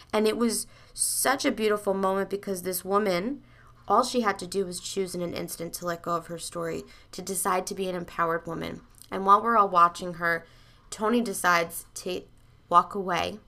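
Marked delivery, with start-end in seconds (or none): none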